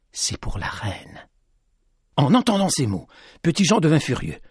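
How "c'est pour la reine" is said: hushed reveal. A narrator's reading. Lady reveals his secret.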